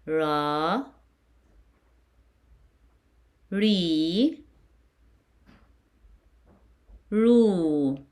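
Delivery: "Ra", "Ri", "Ru" as long rah, ree, roo; vowel drill. Shown as a demonstration. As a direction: rise on "Ra" and "Ri", fall on "Ru".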